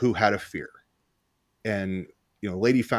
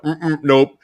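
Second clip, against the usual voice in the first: higher, pinched mock refusal of the scared lady; imitating her refusal; 6 semitones higher, 6 dB louder than usual. Mimicking the snake-fearing client saying no, mock squeamish. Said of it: "She was like".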